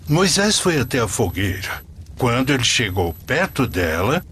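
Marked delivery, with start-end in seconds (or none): none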